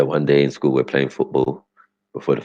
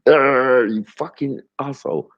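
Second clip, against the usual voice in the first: high, burst of mock fury; mock angry outburst; 11 semitones higher, slower than usual. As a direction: spit it out like a furious kid. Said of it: "I had to fucking".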